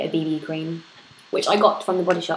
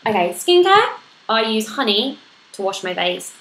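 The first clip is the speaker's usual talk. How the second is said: ordinary delivery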